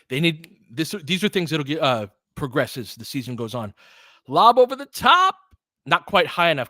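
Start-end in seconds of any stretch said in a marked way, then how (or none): 4.3-6.7 much higher and louder; hyped announcer calling a lob over the top; excited lob call. Real, not acted. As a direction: lift the voice with the lob, then settle.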